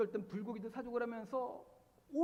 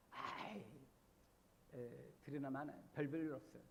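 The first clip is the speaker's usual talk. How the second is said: softer, breathy shrug-off; dismissive scoff; 10 semitones lower, 8 dB quieter than usual. Retold or imitated. Nathanael waving off Jesus from Nazareth, dismissive scoff. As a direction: brush it off as not worth hearing. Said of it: "뭐 이렇게 이야기하는".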